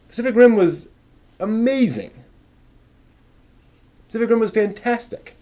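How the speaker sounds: emphatic defence. Real, not acted. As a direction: gush about it like a true fan. Defending Pacific Rim to chat.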